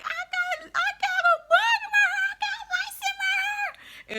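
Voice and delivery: panicked, very high re-enacted screech; hollering. Daughter screaming to mom about lice in her hair, hollering. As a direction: squeal it way up high, in a hammed-up panic. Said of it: "I just got to hollering".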